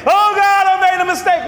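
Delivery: hammed-up loud, high cry of regret; mock cry to God. Imitating a believer crying out to God, loud and high. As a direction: wail it like a sorry sinner.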